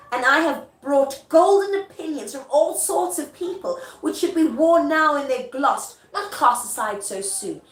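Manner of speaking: high, loud theatrical recitation; earnest stage protest; declaimed Macbeth. Acted. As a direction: declaim it emphatically, slowing at the end. Acting out Macbeth's refusal speech.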